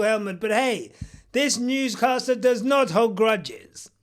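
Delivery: ordinary talk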